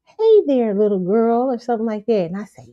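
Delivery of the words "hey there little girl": higher, louder greeting that falls away; man greeting child. Retold or imitated. Man bending down to little girl, friendly greeting. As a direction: call out a hello to a small child. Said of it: "he bends down, he says".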